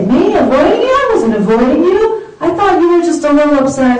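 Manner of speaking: acted high-pitched mock innocence; defensive denial. Spouse accused of avoiding the other, acted defensive denial.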